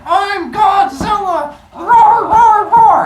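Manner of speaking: hammed-up monster roar stomping ants; Godzilla roar. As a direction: bellow like a kid playing Godzilla. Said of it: "and just going".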